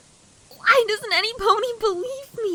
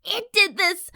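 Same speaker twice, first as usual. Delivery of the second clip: ordinary talk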